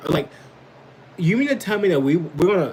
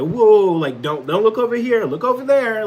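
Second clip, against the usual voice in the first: mock warning; 9 semitones higher, 8 dB louder than usual. Mocking president telling base.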